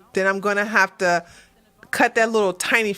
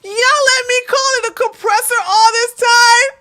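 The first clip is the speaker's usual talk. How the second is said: loud, high-pitched incredulous cry; laughing disbelief; 12 semitones higher, 7 dB louder than usual. Real, not acted. Streamer accusing viewers of not correcting them, laughing disbelief. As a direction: wail it in amused outrage.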